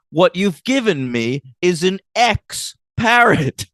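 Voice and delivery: high, slow, over-enunciated sketch voice; Monty Python pet store owner. Retold or imitated. Fan acting out the dead parrot sketch, slow comic imitation. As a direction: space it out like an exasperated comic explanation. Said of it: "that's the rhythm of the sketch".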